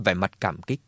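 ordinary delivery, nothing marked

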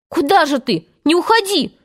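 crying out. A narrator's reading. Boys begging Tootles to stay, high urgent cry. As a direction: call after him, pitch climbing. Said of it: "закричали остальные".

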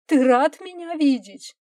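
high, loud, pained question; bitter wife. A narrator's reading. Aged wife faces husband after opening the door, bitter. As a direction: throw the question out sharply.

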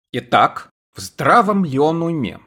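plain reading voice

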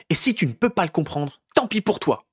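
high defiant retort. A narrator's reading. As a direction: toss it back at him in defiance. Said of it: "sur un ton de défi".

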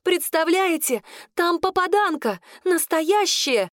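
high, drawn-out gushing announcement; excited crying out. A narrator's reading. Claudine brings sensational news to the kitchen, high excited call. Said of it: "прокричала она на всю кухню".

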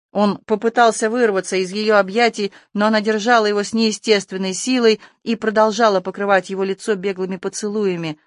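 ordinary delivery, nothing marked

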